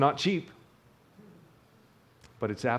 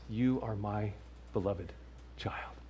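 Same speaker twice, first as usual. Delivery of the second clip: soft, gentle and quiet, as God speaking; quiet fatherly tenderness; tender fatherly voice; 3 semitones lower, 8 dB quieter than usual. Retold or imitated. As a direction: hush it down to a loving whisper of a father. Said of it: "this is what he says".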